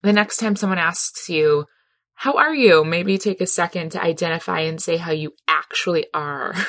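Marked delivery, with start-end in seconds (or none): 2.1-2.8 bright, higher, louder small-talk greeting; chirpy hallway how-are-you; breezy greeting. Retold or imitated. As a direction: toss it off like a passing hello. Casual greeting acted out.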